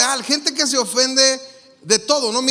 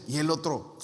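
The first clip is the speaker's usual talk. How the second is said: usual speaking voice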